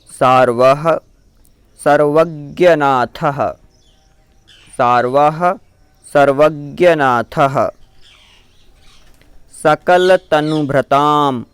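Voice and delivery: measured, syllable by syllable chant-like reading; slow Sanskrit recitation. Shown as a demonstration. Teacher reciting puja text for students, slow and careful. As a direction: recite each word clearly for the learners.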